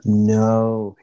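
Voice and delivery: long, loud, drawn-out "no"; shocked outcry. Real, not acted. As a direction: cry out in disbelief, holding the vowel. Shocked reaction to friend's story.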